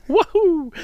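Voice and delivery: squeaky high cartoon whoop; high cheer. Podcast host whoops like a game character, squeaky high. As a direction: whoop it like a video game hero.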